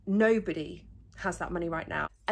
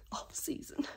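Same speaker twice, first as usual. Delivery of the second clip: whisper; 8 dB quieter than usual. Real, not acted. Breathless aside after laugh.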